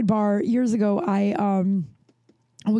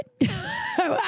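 high, incredulous burst of laughter; disbelieving high laugh. Real, not acted. Baffled by accusation.

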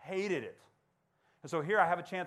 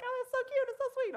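very high, squealy mock of girls gushing. Girls gushing over his name, squealy high mimicry. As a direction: gush like a swooning girl, voice way up. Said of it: "they're like".

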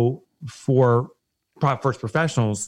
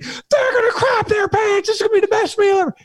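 much higher and louder, laughing through it; gushing Southern rep, laughing; 3 dB louder than usual. Retold or imitated. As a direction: hype the family feast like it's his own. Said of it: "he was so genuinely excited".

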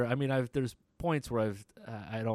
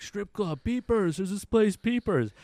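high, loud partying yell; mock drunk crowd hollering; hammed-up drunk crowd, pitched high; rowdy crowd; 10 semitones higher, 6 dB louder than usual. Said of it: "they're like".

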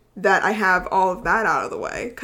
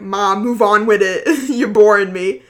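mock New York accent; 8 dB louder than usual. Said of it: "Boston or New York accent".

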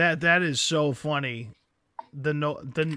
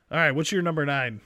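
normal talking voice

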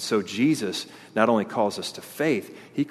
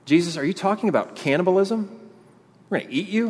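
higher, shocked questioning; higher pitched mock outrage at Jesus; incredulous listener; 7 semitones higher, faster than usual. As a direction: voice the crowd's disbelief. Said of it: "How's that work?".

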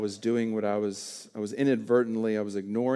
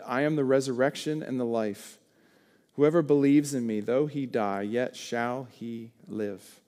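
proclaiming scripture; 4 dB louder than usual. Acted.

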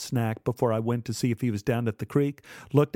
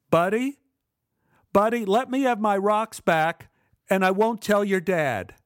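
coaxing plea; 7 semitones higher, 4 dB louder than usual. Acted. Kid coaxing at locked door.

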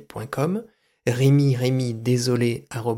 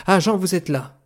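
higher-pitched cry of welcome; exclaiming; 6 semitones higher than usual. A narrator's reading. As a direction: exclaim as the director greeting an expected visitor. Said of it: "s'écria-t-il en levant les bras". (exclamation d'accueil, voix plus haute)

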